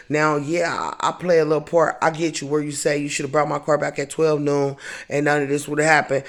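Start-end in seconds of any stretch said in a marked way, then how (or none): none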